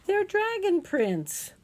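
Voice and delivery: high, loud and slow correction; insistent child. Acted. Child correcting dad about the fingerprints, insistent. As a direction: stress the dragon, drag it out.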